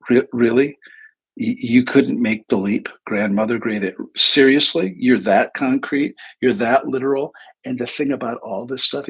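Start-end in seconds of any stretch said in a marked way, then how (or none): 0.1-7.3 loud, incredulous rant; retold exasperation. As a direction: act out being pissed off, hitting each question hard. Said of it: "And I, I got pissed".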